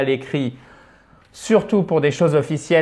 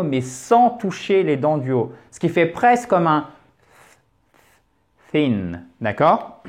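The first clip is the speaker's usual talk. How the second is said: ordinary delivery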